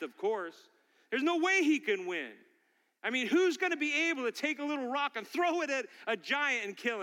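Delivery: high, driving sermon delivery; impassioned preaching. Real, not acted. Pastor to congregation on David's faith in God, impassioned preaching. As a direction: preach it with building fervour.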